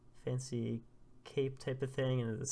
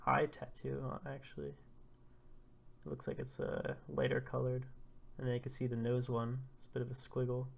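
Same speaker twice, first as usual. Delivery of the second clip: usual speaking voice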